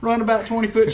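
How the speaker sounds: ordinary delivery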